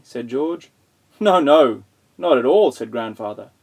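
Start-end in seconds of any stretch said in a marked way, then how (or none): 1.2-2.7 loud, high, emphatic denial; loud insistence. Acted. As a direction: boom out the no. Grandfather insisting loudly.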